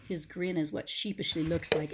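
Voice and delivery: ordinary delivery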